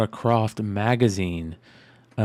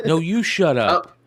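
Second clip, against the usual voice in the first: mock shout; 8 semitones higher, 7 dB louder than usual. Real, not acted. Friends trading mock insults.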